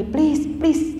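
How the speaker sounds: high begging whine; whining plea. Retold or imitated. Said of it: "dia merengek lagi".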